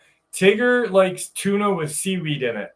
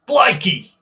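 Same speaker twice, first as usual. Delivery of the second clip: a hammed-up, higher and louder South African exclamation; mock South African accent; 6 semitones higher, 7 dB louder than usual. Guessing how South African people talk, higher and louder. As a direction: blurt Blikey in a clumsy South African accent. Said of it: "How do South African people talk?".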